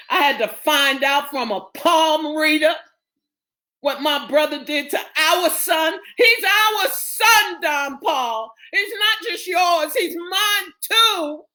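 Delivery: high, rising angry protest; outraged mother. Acted. Mother confronting the father about their son, outraged. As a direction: build from accusation to a high, indignant cry. Margaret confronts Don Paul.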